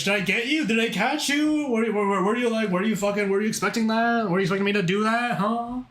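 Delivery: high, put-on Valley Girl; hammed-up Valley Girl questioning. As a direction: switch into a teasing high-pitched girl voice. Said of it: "The voice switch".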